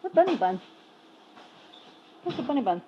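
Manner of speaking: high, loud call for a cat. Real, not acted. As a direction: call the cat sweetly from across the room. Calling for a missing cat.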